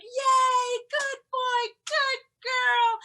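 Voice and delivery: very high, loud squealed praise; erupting in cheers. Retold or imitated. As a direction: burst out cheering for the dogs.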